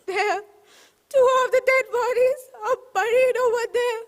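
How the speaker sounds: a woman weeping as she reveals the graves; crying. Acted. Shilpa weeping to the inspector in her garden. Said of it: "She started crying again".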